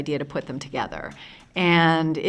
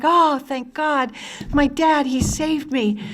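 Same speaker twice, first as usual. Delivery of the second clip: put-on relieved child; 7 semitones higher, 4 dB louder, slower than usual. Child rescued by her dad.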